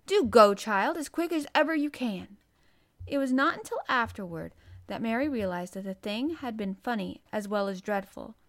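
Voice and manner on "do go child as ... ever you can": louder, pressing nurse; urgent urging. A narrator's reading. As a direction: urge the child to hurry off. Nurse urges child to hurry.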